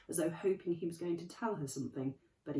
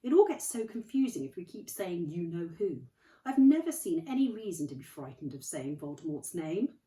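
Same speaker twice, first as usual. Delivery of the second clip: Dumbledore; 8 dB louder than usual. Acted. Dumbledore voiced.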